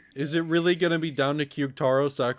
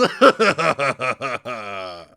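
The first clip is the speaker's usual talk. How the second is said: big, loud mocking laugh; long gloating villain laugh; villain cackle; 3 semitones lower, 6 dB louder than usual. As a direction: cackle over their misfortune. Villain laughs after taunt.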